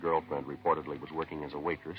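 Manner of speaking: steady, matter-of-fact police report voice; case narration. Acted. Detective telling listeners the case, matter-of-fact narration. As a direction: lay out the facts like a logbook entry.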